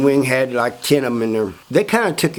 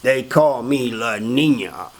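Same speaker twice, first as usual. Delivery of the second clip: slow, drawn-out boast in character; proud self-introduction; 2 semitones lower, slower than usual. Retold or imitated. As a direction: announce your nickname slowly, stretching each word. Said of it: "He started going around".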